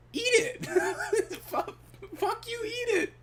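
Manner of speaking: shrieking high through laughter; laugh-crying the order; high squealed demand breaking into laughs; laughing mock yell. Retold outburst, high laughing.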